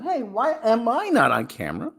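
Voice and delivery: high pretend cat voice; voicing the cat. Voicing the cat asking why he's off camera, high and playful. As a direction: speak for the cat in a high squeaky voice. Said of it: "He's saying".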